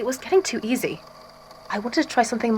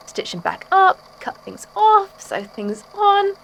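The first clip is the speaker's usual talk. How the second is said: slow, higher, savouring each act; gleeful relish; 10 semitones higher, slower than usual. Acted. Artist boasting of surgery, slow gleeful relish. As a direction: relish every cut and stitch.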